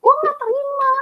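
high, loud mock yelling back; retold yell back. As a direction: shout back at her in a huffy high voice. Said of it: "Jadi gue teriakin balik".

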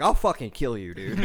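loud mock threat; retold losing it. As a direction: re-enact snapping at the other driver. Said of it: "I lost it immediately".

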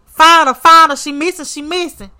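high, loud opening, repeated like an alert; mock urgent alarm. Voicing girls raising the alarm.

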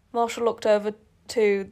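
plain reading voice

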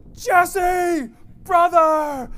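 joyful greeting from afar. Retold or imitated. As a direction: call out his name with huge delight, like spotting a brother blocks away. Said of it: "and he's like".

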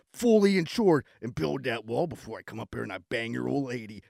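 lightly voiced low macho pitchman; a hint of a wrestler hyping a local business; mock tough-guy ad read. Second parody ad, gruff pitchman.

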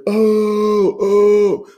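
high, loud, drawn-out "oh"; crowd jeer. Retold or imitated. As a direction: imitate a roomful of teenagers jeering. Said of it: "the standard eighteen-year-old asshole thing to do".

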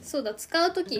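ordinary talk, nothing marked

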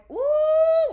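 high, loud, long "ooh"; loud scandalized ooh; drawn-out ooh. Real, not acted. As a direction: let out a big sustained whoop of an "ooh". Exclaims before telling the drama.

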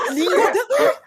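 high, loud laugh into the words; laughing shriek. Real, not acted. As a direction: squeal with laughter. Laughing at the shared joke.

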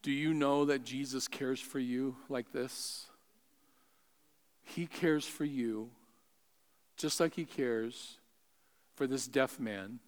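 soft, low, pastoral reassurance; hushed preaching. Real, not acted. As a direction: bring it down low and quiet, with pauses. Preacher's hushed closing appeal.

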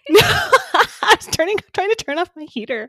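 high, loud, laughing protest. Real, not acted. Explaining the heater noise, laughing high. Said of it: "laughing explanation".

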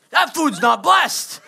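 slow, loud mock rebuke; mock scolding shout. Imitating someone at the table rebuking an early bite, slow and loud. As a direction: bark it slowly like a fussy churchgoer.

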